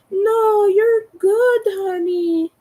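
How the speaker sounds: high, loud mock reassurance, cooed at someone; fake nice. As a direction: imitate over-sweet, patronising comfort. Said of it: "like, fake nice".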